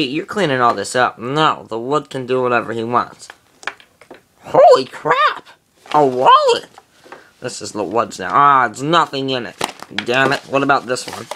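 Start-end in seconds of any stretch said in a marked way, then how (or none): 4.4-6.7 very high, loud, slow exclamation of shock; surprised. Acted. Woody finding a wallet on the roof, shrieking in surprise. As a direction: squeal at the find.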